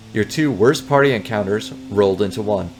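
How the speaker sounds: ordinary delivery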